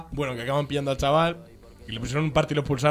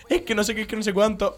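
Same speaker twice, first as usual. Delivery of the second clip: higher-pitched imitation of excuses; mock protesting; 7 semitones higher than usual. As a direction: protest in a raised, put-on voice. Retelling the teacher's excuses.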